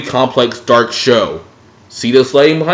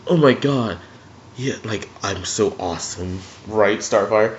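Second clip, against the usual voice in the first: put-on lower, softer smug boy voice; a lightly voiced, self-satisfied Robin; mock cocky Robin; 4 semitones lower, 8 dB quieter than usual. Robin bragging to Starfire, lightly mocking low voice. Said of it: "It was like".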